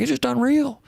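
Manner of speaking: high-pitched imitation of a Southern accent; quick, high Southern accent impersonation; [name]. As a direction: put on an amazed Tennessee Southern accent, pitched well up. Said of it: "in a Southern accent".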